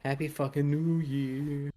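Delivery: high, slow, drawn-out mock greeting; quoted film line. Echoing the friend's holiday quote, high drawn-out imitation. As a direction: imitate a half-remembered quote, stretching it out. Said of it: "Can't remember what that's from".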